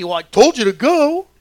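yelling at donkey. Retold or imitated. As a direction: scold the donkey that won't move. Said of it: "he's yelling at his donkey".

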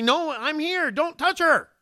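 high, rising urgent plea; Rachel's panicked cry. Pretend. Rachel bursting into the tent to stop Jacob, high urgent plea. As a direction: play the sister bursting in to stop it. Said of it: "run into that tent and say".

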